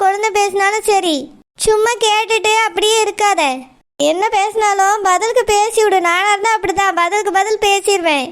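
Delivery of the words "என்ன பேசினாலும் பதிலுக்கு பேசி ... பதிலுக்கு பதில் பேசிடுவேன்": ordinary talk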